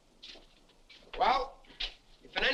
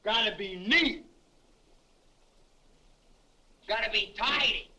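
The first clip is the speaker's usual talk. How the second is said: mocking echo. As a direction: mimic the sergeant's fussy inspection. Soldier parrots sergeant's inspection remarks.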